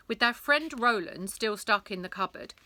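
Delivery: plain reading voice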